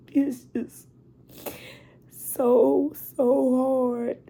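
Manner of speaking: a high, cracking voice fighting back tears; real grief, the voice cracking on each word; choked-up crying; voice breaking. Real, not acted. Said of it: "I didn't mean to get emotional".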